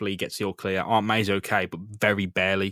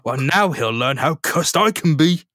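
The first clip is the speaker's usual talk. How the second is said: menacing; 4 semitones higher, 5 dB louder than usual. Retold or imitated. Peter vows revenge on Ock, slow and menacing. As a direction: let the threat simmer, slowly. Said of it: "Ooh, menacing".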